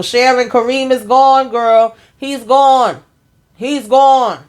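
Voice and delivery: high and loud, calling it out; practically shouting to Sharon that he's gone; a high-pitched, loud, worked-up cry that he's gone; excited exclaim. Real, not acted. She exclaims Kareem is gone.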